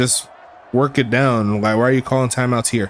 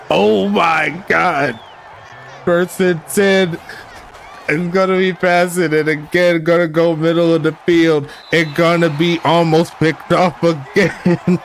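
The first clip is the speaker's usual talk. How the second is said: excited disbelief; 4 semitones higher than usual. Real, not acted. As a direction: react in disbelief at the chaos. Wild back-and-forth interceptions.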